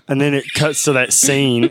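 usual speaking voice

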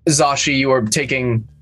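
ordinary delivery

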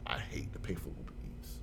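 low whisper, grumbling; whispered grumble. Retold or imitated. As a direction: whisper it like a private complaint. Grumbling to himself about fees.

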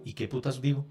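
stuck for words. Acted. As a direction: say it low, as if stumped before recording. Acts out being stuck for words.

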